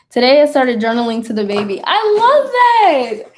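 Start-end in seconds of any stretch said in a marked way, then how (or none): 1.8-3.3 high, loud, stretched-out gush; delighted. Real, not acted. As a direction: squeal it slowly with delight. Delighted reaction to journaling news.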